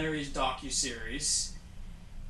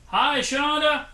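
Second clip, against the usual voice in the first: loud, high shout of greeting; calling out; 10 semitones higher, 8 dB louder, slower than usual. Real, not acted. Co-host hails Shonda back in the truck, calling out. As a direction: holler hello to someone far off.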